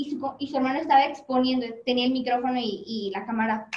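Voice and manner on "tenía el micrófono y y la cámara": ordinary delivery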